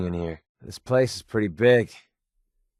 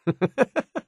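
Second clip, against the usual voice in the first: gleeful giggle; 13 semitones higher than usual. Real, not acted. Delighted at his own cliché.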